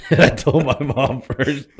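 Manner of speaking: real laugh through high, loud, slow words; laughing while talking. Real, not acted. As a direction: tell it while cracking up, pitched high. Cracks up telling the story.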